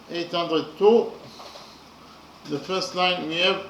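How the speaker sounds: usual speaking voice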